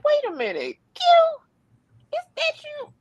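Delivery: very high, climbing surprised recognition; imitating a startled officer, voice soaring; voice shot up. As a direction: squeak up in disbelief on "is that you". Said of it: "the way his voice, like, shot up".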